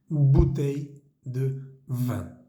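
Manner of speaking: Bouteille de vin very slow, b with both lips; slow French model. Shown as a demonstration. Teacher models bouteille de vin for learners, slow. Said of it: "bouteille comienza con B".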